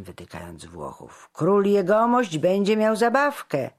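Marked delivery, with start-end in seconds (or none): 1.3-3.7 loud, high taunt; heckler's raised voice; jeering. A narrator's reading. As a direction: call out a mocking jibe. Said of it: "szydził weselszy".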